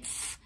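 "ف-" as voiceless breath alone; just blow the f in "ف-", no vowel; blown f sound. Shown as a demonstration. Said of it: "انفخ بقى كده". ("ف-" نفخ بس من غير حركة)

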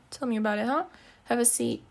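usual speaking voice

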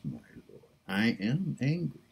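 ordinary talk, nothing marked

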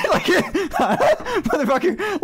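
real burst of laughter, pitch jumping high; laughing. Real, not acted. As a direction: laugh through the swear. Laughs while telling the mix-up.

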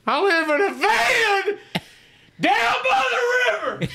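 high, slow, shouted Matt Foley bellow; Matt Foley impression. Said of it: "So then I'm like".

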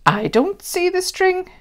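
put-on high child's puzzled voice; a small boy puzzled, pitched high. A narrator's reading. Child voice in story.

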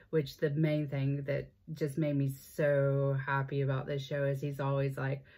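ordinary talk

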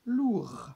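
"lourd" ends on the R, the D unsaid; silent D in lourd. Shown as a demonstration. Teacher repeating "lourd" to show no E, no D. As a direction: say "lourd" as "lour". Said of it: "il n'y a pas de E après".

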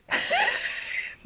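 high breathy giggle. Real, not acted. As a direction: burst into a squeaky laugh. Laughing at garage joke.

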